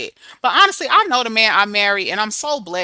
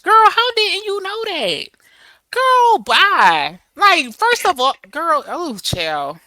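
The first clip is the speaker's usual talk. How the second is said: mock disbelief; 7 semitones higher, 6 dB louder, much slower than usual. Mocking the cheated wife.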